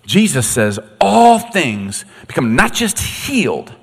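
ordinary talk

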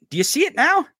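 high, gleeful rising questions; excited. Real, not acted. Host gloats to his chat, high gleeful questions. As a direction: egg the chat on.

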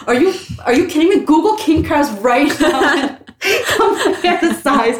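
high-pitched retelling of an outraged sibling, laughing; mock-outraged challenge, laughter breaking in; heated retort. Sister challenging her brother over king crabs, heated retort. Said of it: "So I getting fucking heated".

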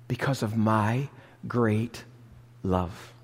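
God's gentle answer, softly; tender hush. Acted. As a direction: drop low and soft on great love. Tender climax as God.

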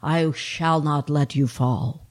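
low, slow divine promise; voice of God. Acted. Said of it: "said the voice".

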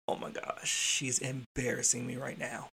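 hushed, under-the-breath aside; embarrassed undertone. Retold or imitated. Embarrassed educator to himself about a yelling teacher, hushed. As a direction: let it slip out low, for nobody else's ears. Embarrassed inner thought, retold.

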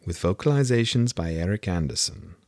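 high, dramatic storybook narrator intro; grand, measured title read; audiobook title announcement. Acted. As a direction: announce the chapter with theatrical flourish. Reads book title in story voice.

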